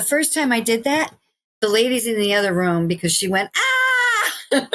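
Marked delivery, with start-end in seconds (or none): 3.6-4.7 high mock scream of pain; imitated scream. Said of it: "she was screaming".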